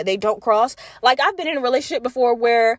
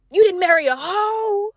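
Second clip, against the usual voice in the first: pitched-up, scoffing echo question; incredulous echo; 7 semitones higher than usual. Retold or imitated. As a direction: throw it back as a scandalized question. Mockingly echoes husband's claim in disbelief.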